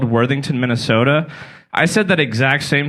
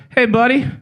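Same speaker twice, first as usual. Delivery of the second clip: a light, raised chummy cop voice; mock friendly cop; 8 semitones higher than usual. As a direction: play a chummy cop, pitched up. Cop's quote, pitched up.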